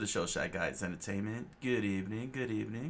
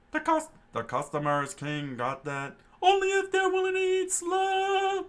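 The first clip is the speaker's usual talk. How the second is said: much higher, squeaky mocking cook voice; sneering cook; 7 dB louder than usual. Cook mocking the customer, high and squeaky.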